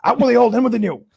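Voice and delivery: hammed-up boss, high at times; Steinbrenner impression. Steinbrenner praising George, hammed-up boss voice. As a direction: boom out the boss's praise. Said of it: "Steinbrenner's like".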